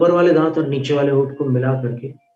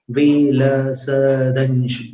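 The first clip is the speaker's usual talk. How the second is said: विलसदंशु spelled out slowly, vi-la-sa-dan-shu; slow careful syllables; much slower than usual. Shown as a demonstration. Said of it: "ऐसा उच्चारण करना".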